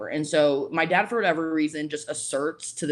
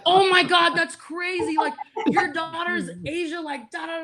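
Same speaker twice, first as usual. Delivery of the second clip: high-pitched squeal imitating starstruck kids; hammed-up fangirl freak-out; 14 semitones higher, slower than usual. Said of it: "just start freaking out".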